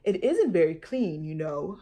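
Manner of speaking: father warning his daughter; lightly voiced cautious father; father's voice. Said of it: "her father said".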